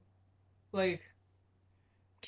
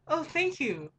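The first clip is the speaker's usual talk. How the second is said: higher, sugary service voice; fake polite; 6 semitones higher than usual. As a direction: put on the customer-service face. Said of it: "You have to be like".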